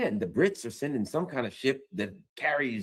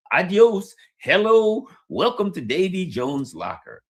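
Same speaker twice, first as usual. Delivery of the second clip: loud, raised, taunting farewell; mock send-off; 5 semitones higher, 6 dB louder than usual. Mocking the French as missiles hit, loud mock send-off. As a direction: call it out like a cheery greeter.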